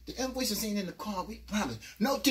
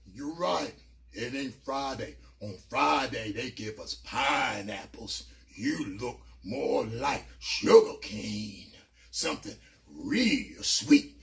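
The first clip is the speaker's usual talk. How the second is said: much lower, slow, creepy voice; menacing big dude; 10 semitones lower, much slower than usual. Retold or imitated. Cannon taunting the narrator. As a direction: leer it slowly and deep. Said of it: "He said".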